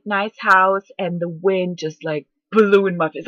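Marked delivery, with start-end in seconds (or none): none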